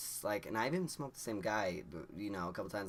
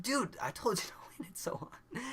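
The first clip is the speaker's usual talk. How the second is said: laughing whisper, much higher; whispered chiding; 14 semitones higher than usual. Retold or imitated. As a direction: hiss it with a giggle. Friend chides, whispered quote.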